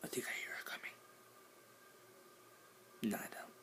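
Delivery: hushed secret whisper. Real, not acted. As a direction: keep it down, whisper. Whispering about mom.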